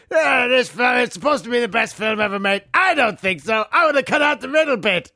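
high shouted pirate rant. Acted. Pirate character ranting.

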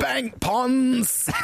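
very high, held-out holler; silly shout. Pretend. Co-host topping his partner's daft word, silly shout.

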